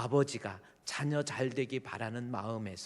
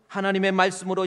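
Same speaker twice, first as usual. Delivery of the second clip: ordinary delivery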